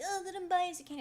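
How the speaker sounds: high, loud sing-song cashier voice; mock cashier. Teller imitates the cashier urging candy, high chirpy voice. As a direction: chirp it like an oblivious nice cashier. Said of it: "He's like".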